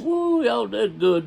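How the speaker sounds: high, drawn-out admiring exclamation; impressed whoop. Retold or imitated. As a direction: imitate an onlooker whooping over a fine catch. Warden admires the stringer of bass.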